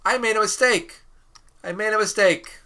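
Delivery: high, loud exclamation; loud confession. Pretend. Teacher owning up to students about an error, loud exclaiming. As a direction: announce the blunder to the whole room.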